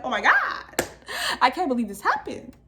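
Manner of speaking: high, loud crying outburst. Retold or imitated. After he drives off, bursting into crying relief. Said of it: "I just burst into tears".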